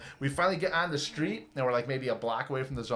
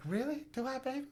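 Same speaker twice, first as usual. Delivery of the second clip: coy surprise; 10 semitones higher, 10 dB quieter than usual. Retold or imitated. Hooker surprised, voiced high.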